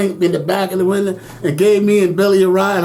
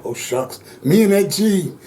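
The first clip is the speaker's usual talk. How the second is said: ordinary talk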